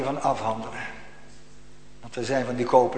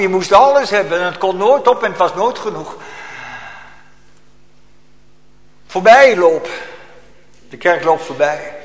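loud, higher, urgent preaching; preaching at full force; 5 semitones higher, 9 dB louder than usual. Acted. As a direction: hammer each line home.

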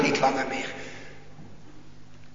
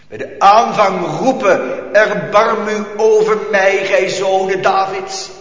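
louder cry, words stretched out; loud preaching; 5 semitones higher, 9 dB louder than usual. Shown as a demonstration. Preacher crying out to his congregation, loud. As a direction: call it out to the church.

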